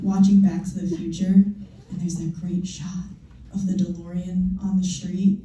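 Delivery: usual speaking voice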